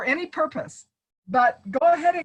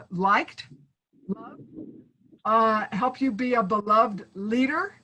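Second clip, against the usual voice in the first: ordinary talk, nothing marked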